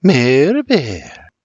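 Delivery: slow, drawn-out teasing name; slow seductive whisper. A narrator's reading. Mary stares William down, slow and seductive. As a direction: linger seductively over each syllable. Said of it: "only slowly and seductively".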